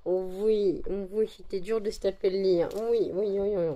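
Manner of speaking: normal talking voice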